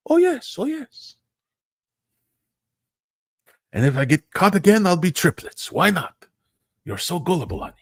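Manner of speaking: playfully smug telenovela cad; smug husband. Acted. Husband gloats in acted telenovela.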